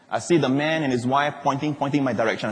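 ordinary talk, nothing marked

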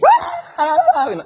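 high-pitched film war cry; whooping cry from an old western; imitated war whoop. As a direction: let out a mock chief's whoop. Imitates cowboy-film Indian chief's cry.

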